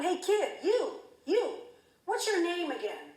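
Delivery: mock shout across the pool; coach yelling down. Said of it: "he yelled down".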